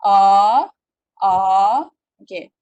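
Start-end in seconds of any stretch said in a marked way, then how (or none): 0.0-1.3 A swept upward, loud; rising tone demo. Shown as a demonstration. Teacher demonstrating rising tone two, loud. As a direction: push a up the hill. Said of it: "So, dia naik".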